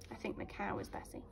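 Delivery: ordinary delivery, nothing marked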